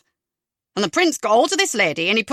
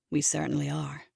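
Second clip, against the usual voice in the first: low, soft murmur under the breath; 7 semitones lower, 8 dB quieter than usual. A narrator's reading. Larry murmuring to himself in the theatre, low and soft. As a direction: murmur it to yourself, lower and softer. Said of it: "Larry murmurs".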